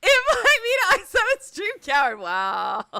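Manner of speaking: laughing through the words; words breaking into laughter. Real, not acted. Laughing hard mid-joke on stream.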